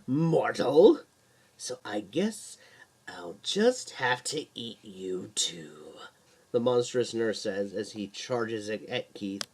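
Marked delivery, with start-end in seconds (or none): 0.0-1.0 slow, louder monster snarl; slow, sinister creature voice; menacing monster. Acted. Monster nurse threatens Keith. 1.6-5.9 slow, menacing whispered threat; slow, whispered monster menace; sinister whisper. Acted. Monster nurse threatens Keith.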